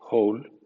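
whole said the English way; English word whole. Shown as a demonstration. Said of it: "por ejemplo". (whole en inglés)

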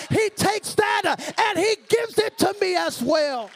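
loud, high, shouted sermon climax; full-force preaching. Real, not acted. Preacher defying the devil before the congregation, full-force shouted preaching. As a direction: shout it to the congregation in driving phrases.

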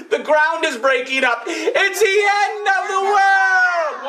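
teacher leading the class in a panicked chant; mock panic. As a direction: cry out like the sky is falling. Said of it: "Let's practice".

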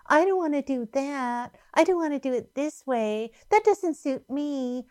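Satan's self-will. Retold or imitated. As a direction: imitate someone insisting on their own way. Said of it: "Do you notice how he said".

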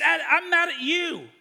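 high, loud retort; comically fired-up comeback; mock angry. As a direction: play up being cross with a friend. Retold quarrel, speaker retorts.

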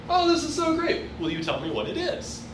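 high, sugary gushing over a scribble; gushing praise to child. Pretend. Teacher mimics adult gushing to a child, high sugary praise. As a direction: coo over the drawing like a doting adult.

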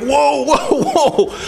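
high startled whoa, backing away; a cornered bird caught off guard; startled eagle. Acted. Eagle backs off from hen.

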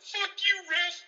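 high, loud yell at the ref; heckling fan. Retold or imitated. An angry fan heckles the referee from the stands, high shout. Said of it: "how many times I've heard that shit".

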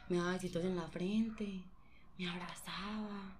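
soft, tender whisper. Real, not acted. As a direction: whisper it like a secret. Tender memory told in whisper. (susurro tierno)

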